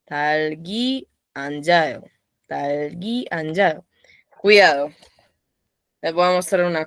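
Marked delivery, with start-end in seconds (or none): 0.1-3.8 slow read-along. Shown as a demonstration. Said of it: "vamos a leer entre todos".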